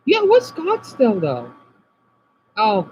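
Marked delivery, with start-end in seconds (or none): 0.1-1.5 high incredulous question; surprised. Real, not acted. Streamer reading a chat comment, surprised. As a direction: jump up in disbelief.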